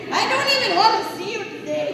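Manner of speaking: high, fast, peevish whine; hammed-up huffy rejection; fed-up brush-off. Crying rabbit sends the trickster away, fed up. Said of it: "I'm in no mood for tricks".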